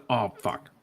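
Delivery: normal talking voice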